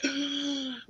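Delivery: high voiceless gasp, imitating a lost voice; pretending to croak out words with laryngitis; mock gasping whisper. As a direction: act out trying to speak with no voice. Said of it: "And I was like".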